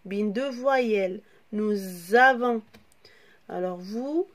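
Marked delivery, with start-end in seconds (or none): 1.5-2.6 "Nous avons" said slowly, with a clear z sound linking "nous" to "avons"; slow, carefully pronounced "nouz-avons" liaison; liaison demo. Shown as a demonstration. Teacher showing pupils the liaison, slow "nouz-avons". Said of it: "On lit Z parce que le S".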